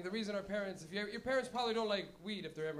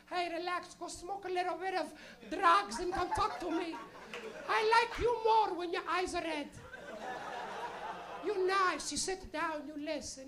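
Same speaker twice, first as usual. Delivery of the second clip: high Portuguese-accented mother impression; short, pointed phrases as a Portuguese mom; little Portuguese lady; 8 semitones higher, slower than usual. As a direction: imitate a Portuguese mother lecturing her son, high-pitched. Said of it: "she's a little Portuguese lady".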